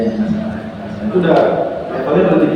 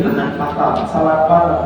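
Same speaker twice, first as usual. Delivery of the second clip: ordinary talk, nothing marked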